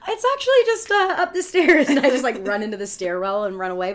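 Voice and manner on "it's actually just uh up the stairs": much higher and louder, laughing it off; embarrassed sing-song backpedal; sheepish. Retold or imitated. Telling the driver the food is upstairs, sheepish and high. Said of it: "And I'm like".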